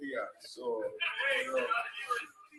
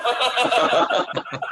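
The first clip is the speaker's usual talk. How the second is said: loud burst of laughter; hearty laugh; 9 semitones higher, 7 dB louder than usual. Real, not acted. Telling a team story, a loud burst of laughter.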